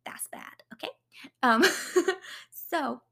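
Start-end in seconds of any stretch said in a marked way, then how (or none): 0.0-0.9 whispered verdict. Real, not acted. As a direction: hush down to a secret. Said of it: "whispered aside".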